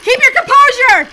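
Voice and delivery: high, loud, frantic cheer; loud self-urging. Real, not acted. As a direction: shout encouragement at yourself. Frantic timed game, urging herself.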